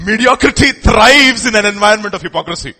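loud, stressed repeat of the line; emphatic proclamation. Shown as a demonstration. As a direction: proclaim it boldly to the crowd. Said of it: "I just put that out of thin air".

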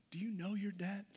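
asked softly, almost to themselves; soft searching question. Real, not acted. Pastor asking the congregation, softly and searchingly. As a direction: let it land gently, a quiet probing question.

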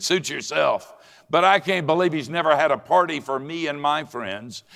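high-pitched acted resentment; angry young man. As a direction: complain bitterly as the wronged son. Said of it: "how angry I am".

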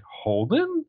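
high-pitched, rising disbelief; surprised question. Retold or imitated. Retelling her seeing Holden unexpectedly, surprised high question. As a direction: stretch the name upward in surprise.

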